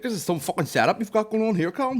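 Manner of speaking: high, loud, amazed praise; impressed friend. Retold or imitated. Friend praising the club setup, high and amazed. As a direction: pitch it way up like an awed mate. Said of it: "He's like".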